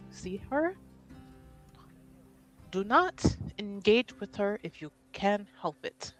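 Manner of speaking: low-voiced, deliberate confidential instruction; hushed warning. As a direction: warn them quietly, softly, with measured pauses. In character, confidential warning to companion.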